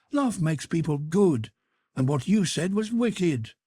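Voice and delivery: a teasing sister scolding her brother; young Sybil, gently scolding. Acted. Sybil to her jealous brother, playfully scolding.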